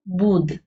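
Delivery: बूद said bare; without tippi. Shown as a demonstration. Teacher shows बूद without tippi, no nasal. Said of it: "अंतर पड़ गया".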